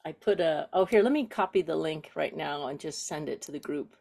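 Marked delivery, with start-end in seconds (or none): none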